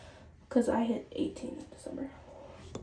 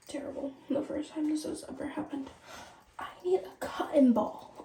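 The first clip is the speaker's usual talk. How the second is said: ordinary talk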